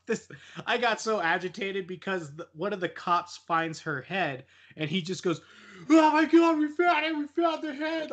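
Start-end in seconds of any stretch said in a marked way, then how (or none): 5.9-8.1 much higher, loud screaming cop; retold scream. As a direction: scream it out like a panicked cop who found the head. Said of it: "screaming it as loud as he can".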